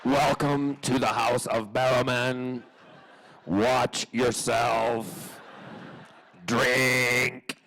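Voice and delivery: loud, slow robotic Dalek commands. Acted. Imitating his talking Dalek greeting house guests, slow and loud. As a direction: stretch each word like a menacing animatronic. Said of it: "Who does the voice of the Daleks".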